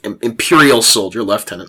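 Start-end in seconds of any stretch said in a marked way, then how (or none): 1.2-1.7 "lieutenant" said as "leftenant"; British "lieutenant": "lef-TEN-ant"; British pronunciation. Shown as a demonstration. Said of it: "this is a Brit".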